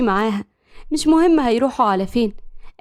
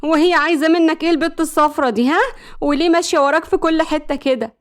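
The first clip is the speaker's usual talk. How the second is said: jealous questioning, pitched higher; prickly, raised jealous voice; 5 semitones higher than usual. Acted. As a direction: demand to know who that girl is. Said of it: "مسلم لاحظ غيرتها".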